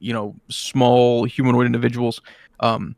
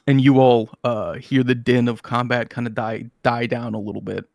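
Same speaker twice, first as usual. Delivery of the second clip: usual speaking voice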